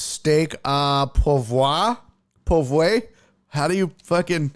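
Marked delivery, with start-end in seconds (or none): 0.0-3.0 "Steak à pourvoir" sounded out slowly, unsure, rising; fumbled French. Shown as a demonstration. Asking chat how to say the dish, unsure French attempt. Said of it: "How do you pronounce whatever that is?".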